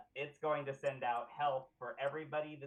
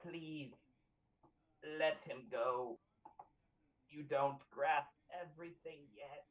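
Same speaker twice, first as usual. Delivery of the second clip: gurgled gross voice. Acted. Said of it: "in his gurgled and gross voice".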